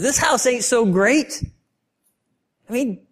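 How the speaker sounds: lightly voiced wife airing grievances; aggrieved wife. Wife voicing her grievances.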